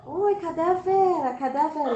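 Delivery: put-on a child clapping and calling for her friend at the door. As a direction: call into the house while clapping. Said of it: "batendo palma".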